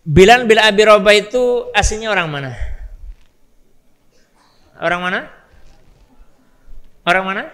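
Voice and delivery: normal talking voice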